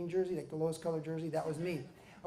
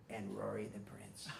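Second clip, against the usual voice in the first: unvoiced, very soft; whisper; 10 semitones lower, 13 dB quieter than usual. Real, not acted. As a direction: hush it like royalty arrives.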